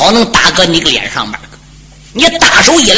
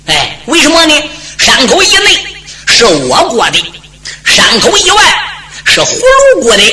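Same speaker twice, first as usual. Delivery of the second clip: climbing much higher on each border phrase; emphatic explaining; 8 semitones higher than usual. Acted. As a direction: lecture him, pitch soaring. 守将强调国界. (越说越高的强调)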